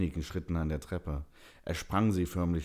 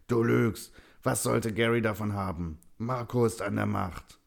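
put-on loud, raised, raging old man; furious accusation; 5 semitones higher, 5 dB louder than usual. A narrator's reading. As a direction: accuse him angrily at high volume. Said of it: "Der alte Mann schäumte vor Wut".

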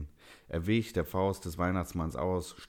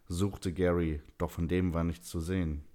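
usual speaking voice

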